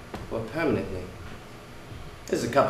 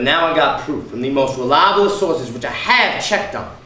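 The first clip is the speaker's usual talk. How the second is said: raised voice; 6 dB louder than usual. Acted. Husband telling his wife he has proof, raised voice. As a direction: press the proof home loudly. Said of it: "Lower your voice".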